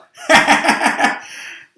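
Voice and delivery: big, high, hammed-up cackle of glee; roaring laugh. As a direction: let out a huge mocking roar of laughter. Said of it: "roared with laughter".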